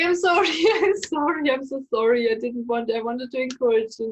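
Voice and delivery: loud, high apologizing that gradually settles; retold flustered apology. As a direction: blurt out mock-frantic apologies. Retold hurried apology after her breakdown.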